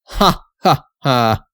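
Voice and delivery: short scornful ha ha ha; mocking laugh. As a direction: laugh off the farmer's claim. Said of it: "the sentry burst out laughing".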